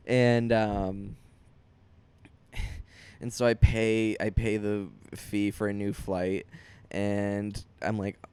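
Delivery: ordinary talk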